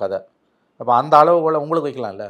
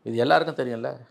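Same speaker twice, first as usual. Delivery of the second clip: ordinary talk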